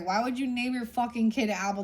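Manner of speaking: usual speaking voice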